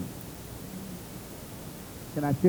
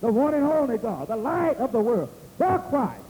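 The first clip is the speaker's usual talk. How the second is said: high, ringing proclamation; emphatic pulpit declaration; preaching; 9 semitones higher than usual. Acted. Preaches at full force.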